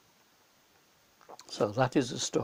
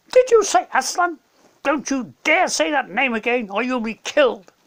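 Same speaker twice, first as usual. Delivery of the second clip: much higher, louder threat; furious witch; 10 semitones higher, 6 dB louder than usual. Acted. Witch forbids Edmund to say Aslan's name, furious. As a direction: spit out the threat like a wicked queen.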